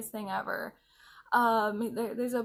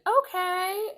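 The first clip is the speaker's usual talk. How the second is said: drawn-out, high, louder okay; high-pitched mock okay; 9 semitones higher, 5 dB louder than usual. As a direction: quote him high and loud. Acting out his reply.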